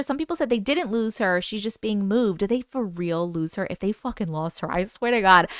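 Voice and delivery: normal talking voice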